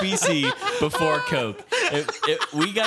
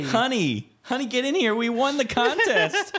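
pitched-up excited ant; high ant voice; 9 semitones higher than usual. Retold or imitated. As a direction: call out like a thrilled ant.

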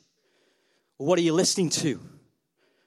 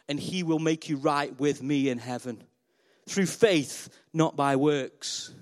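tender voice of God; 5 semitones lower than usual. Acted. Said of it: "he turns to you and says".

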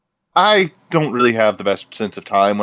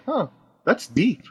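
slow, softer musing, higher pitched; impressed reaction; 9 semitones higher, 5 dB quieter, slower than usual. Acted. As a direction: act out being struck by a profound thought. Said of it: "In their accent, I'm like".

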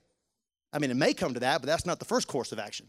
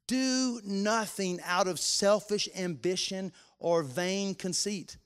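reading scripture, swelling louder and higher; building scripture reading; 5 semitones higher, 5 dB louder than usual. Acted. Pastor reading Philippians to the congregation, building to fervent preaching. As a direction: preach the passage with rising fervour.

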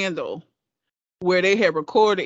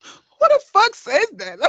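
shocked disbelief; 11 semitones higher, 5 dB louder than usual. Real, not acted. Shocked outburst at the tape.

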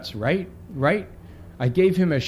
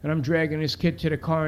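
ordinary delivery, nothing marked

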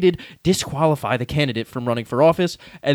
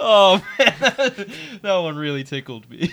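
being tickled; 4 semitones higher than usual. Pretend. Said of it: "I'm actually under the table tickling Danny".

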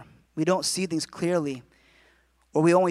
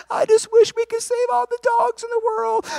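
very high wailing weepy voice; hammed-up tearful blubbering; mock bawling; 4 dB louder than usual. Person bawling over a dog commercial, mock sobbing. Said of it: "They're bawling and they're watching T V".

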